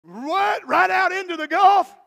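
loud, high, disbelieving shout; astonished shout. Acted. Crowd astonished at walking into the Gulf, shouting. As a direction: yell it in disbelief.

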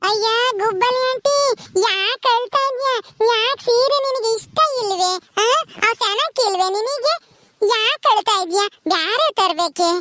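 put-on high, slow, drawn-out kid voice; inquisitive child. Child asks aunty if saris displease her, childlike. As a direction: quiz her slowly like a little child.

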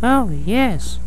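very high little mole voice; Mama Mole. Acted. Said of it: "said Mama Mole".

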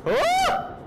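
sudden high yelp of fright; high startled oh. Real, not acted. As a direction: yelp as it lurches. Startled on a scary ride.

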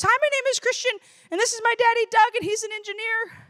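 high-pitched imitation of a little boy; little kid voice. Little boy presenting his dad at career day, high imitated kid voice. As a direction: introduce your daddy like a small child.

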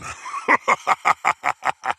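rapid staccato evil laugh; villain laugh. Acted. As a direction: laugh in a run of short, menacing bursts. Villain announcer laughs at the battle.

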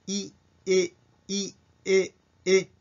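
"い" gliding to "え" with only the tongue dropped. Shown as a demonstration. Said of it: "そのいの口でベロだけを下げて".

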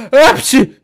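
high, loud sneeze. Acted. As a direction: burst out in a squeaky sneeze. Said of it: "çok hapşırıyorlar". (hapşırma)

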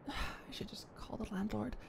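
sighing, very soft whisper; resigned whisper. Acted. Deciding to phone the landlord, resigned whisper. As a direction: give up and whisper it.

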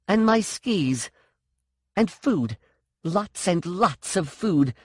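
high, firm list of demands; demanding. A narrator's reading. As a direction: dictate terms, leaning on each item. Sean dictating demands in story.

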